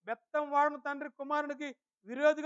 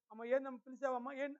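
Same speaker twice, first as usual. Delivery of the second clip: gentle reply; 8 dB quieter than usual. Acted. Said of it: "మృదువుగా".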